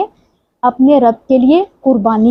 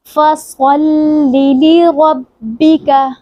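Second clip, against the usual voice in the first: slow tajweed recitation; 3 semitones higher, much slower than usual. Shown as a demonstration. Teacher recites फसल्लि लिरब्बिका slowly for practice. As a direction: intone it with a rounded saad. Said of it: "मुंह गोल करके".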